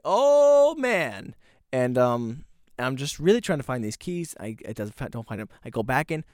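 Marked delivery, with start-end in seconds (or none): 0.0-1.4 loud, high, drawn-out groaning cry; hammed-up dismay. Storyteller relives checking the van for keys, in loud mock dismay. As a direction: wail it in mock despair. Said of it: "I'm like".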